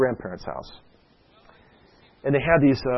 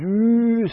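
spooky ooh; 10 semitones higher, 4 dB louder than usual. Pretend. Pretend zombie chasing a kid.

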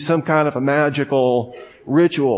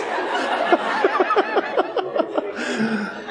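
high breaking laugh after holding it in; burst of laughter; 5 dB quieter than usual. Real, not acted. Trying so hard to keep a straight face.

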